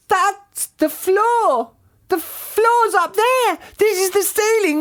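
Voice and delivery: very high, drawn-out panic; character's scream. A narrator's reading. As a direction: shriek slowly in disbelief. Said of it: "screamed Missus Twit".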